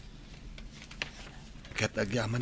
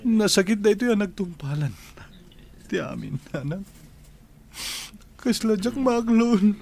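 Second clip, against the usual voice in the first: tearful grief; 8 semitones higher than usual. Acted. Son cries out to his mother about his dead wife, anguished and breaking. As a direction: pour out the pain of losing his wife, slowing on the last words.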